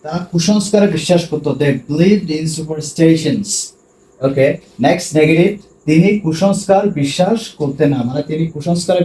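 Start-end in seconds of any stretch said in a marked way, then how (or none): 1.5-3.5 slow dictation; slow model sentence. Shown as a demonstration. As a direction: lay it out slowly for students. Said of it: "teacher models sentence slowly".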